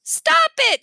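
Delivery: high, loud shout. A narrator's reading. Jimmy shouting at the parrot to stop. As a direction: yell it at the bird. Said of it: "Jimmy shouted".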